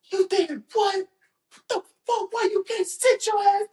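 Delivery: hammed-up outraged coach; angry coach. Coach scolding athlete for tearing his ACL, furious.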